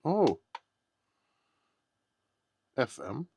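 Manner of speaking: ordinary delivery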